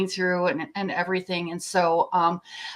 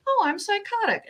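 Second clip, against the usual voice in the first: mock breezy excuse; 11 semitones higher than usual. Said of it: "then I could say".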